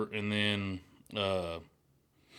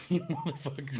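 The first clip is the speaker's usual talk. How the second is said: laughing curse; 6 semitones higher, 4 dB quieter than usual. Retold or imitated. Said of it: "I'm like".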